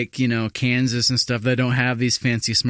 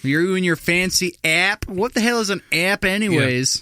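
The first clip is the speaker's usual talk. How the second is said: put-on grumpy old caller voice; 7 semitones higher than usual. Continues mocking the caller.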